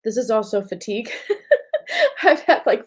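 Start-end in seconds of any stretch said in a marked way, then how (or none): 1.2-2.2 sudden high-pitched giggle burst; high tired giggle. Real, not acted. Tired laugh at own fatigue. 2.2-2.9 laughing through the words. Real, not acted. Laughing about lack of sleep.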